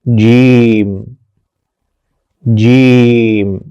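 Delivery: جیم stretched very long the second time; drawn-out letter. Shown as a demonstration. Teacher slowly modelling جیم for students to repeat. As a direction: hold the vowel of جیم slowly. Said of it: "تو بولیے".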